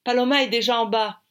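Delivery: loud, high announcement; announcing. A narrator's reading. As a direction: call the news out to the group. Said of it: "Audrey annonce".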